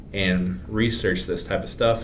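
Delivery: ordinary talk, nothing marked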